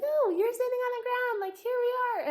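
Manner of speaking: very high, bouncy puppy voice; excited dog voice. Retold or imitated. Owner voicing her dog as it jumps on her, high and eager.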